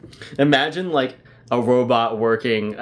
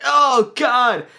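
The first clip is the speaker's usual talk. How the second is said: disgusted guy; 11 semitones higher, 3 dB louder, slower than usual. Pretend. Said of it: "the guy behind you is like".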